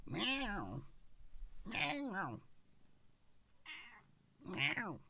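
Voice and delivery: high, soft mock meow; cat meow. Host answers the cat with playful meows. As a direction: miaow like a little cat.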